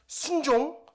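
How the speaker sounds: a short, loud, punchy one-word order; husband pointing at his wife, barking a mock order; pointed command. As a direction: jab the word out like pointing a finger. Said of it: "손가락질을 딱 하면서".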